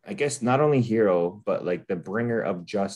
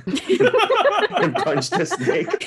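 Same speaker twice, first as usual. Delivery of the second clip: high squeaky laughing words; words squeezed out through laughter; giggling while telling; 5 dB louder, much slower than usual. Real, not acted. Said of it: "listeners laugh".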